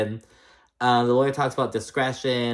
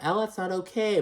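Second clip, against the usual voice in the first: forced sweetness; 9 semitones higher, 3 dB quieter than usual. Retold or imitated. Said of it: "trying to be all, like, sweet".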